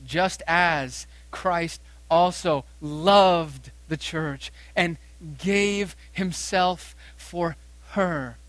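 very slow, each word weighed; slow deliberate reading. Acted. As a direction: draw out the verse word by word. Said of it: "Watch this".

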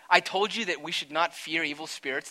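ordinary talk

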